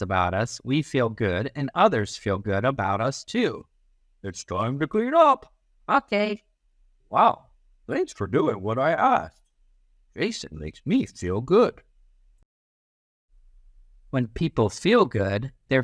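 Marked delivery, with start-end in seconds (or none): 4.2-6.4 put-on teacher and child. 7.1-11.8 grateful adult voice. Acted.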